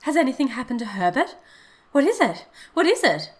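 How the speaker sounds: frantic, high, loud questioning; mother's mounting alarm, pressing for an answer; breathless alarm. A narrator's reading. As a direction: demand news, climbing into panic. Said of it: "she asked breathlessly".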